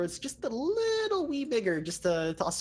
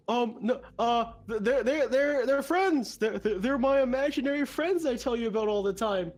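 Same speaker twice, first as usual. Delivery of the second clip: high, flustered stammer; nervous stammering ogre; 6 semitones higher than usual. Acted. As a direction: stammer out a panicked excuse. Gorgo stammers excuse to elemental.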